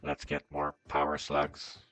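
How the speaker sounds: deep robot voice filter. Real, not acted. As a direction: sound like a machine talking. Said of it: "I should be talking like a robot".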